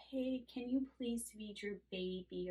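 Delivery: slow, soft, hesitant plea; tentative, unconfident asking; timid request. Retold or imitated. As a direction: ask the mom meekly, almost apologizing. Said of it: "I'm not like".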